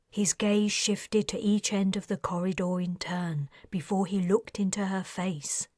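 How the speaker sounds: usual speaking voice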